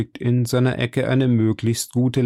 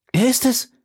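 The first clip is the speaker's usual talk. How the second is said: high, agitated outburst; crying out; 12 semitones higher than usual. A narrator's reading. D'Artagnan recognising the man described, crying out. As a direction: cry out in sudden recognition. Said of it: "rief D'Artagnan".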